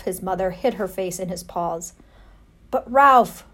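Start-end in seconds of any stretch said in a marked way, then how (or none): 2.7-3.4 a loud, higher, trembling aunt; fearful aunt crying out; shaky alarmed plea; quavering. Acted. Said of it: "quavered Aunt Sissy".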